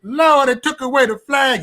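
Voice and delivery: high, slow whiny mock wailing; mock lament. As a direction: whine like a sulking mourner, drawn out.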